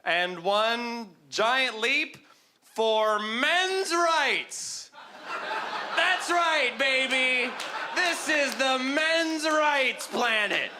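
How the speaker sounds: slow, high, grandiose speech; pompous proclamation. Pretend. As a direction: proclaim it slowly like history. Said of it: "You could be like".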